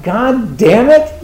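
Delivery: slow, loud, high exasperated curse; drawn-out mock-exasperated cry; frustrated outburst. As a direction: let the frustration burst out. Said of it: "sometimes you wanna cry".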